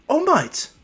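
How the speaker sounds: high, loud startled cry; high-pitched shock, falling at the end; startled mother. Acted. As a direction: blurt the name in surprise.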